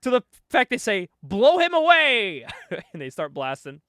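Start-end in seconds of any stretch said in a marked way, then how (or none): 1.2-2.5 high, loud, drawn-out yell; mock battle cry. Host voicing guards opening fire, hammed-up shouted command. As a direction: holler the firing order with relish.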